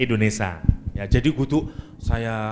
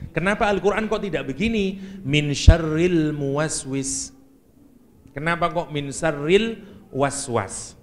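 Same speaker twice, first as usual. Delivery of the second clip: ordinary delivery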